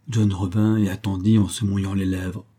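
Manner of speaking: ordinary delivery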